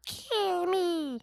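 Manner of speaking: very high cartoon squeak; squeaky mock plea. Reacting to the mutant hybrid, high squeak.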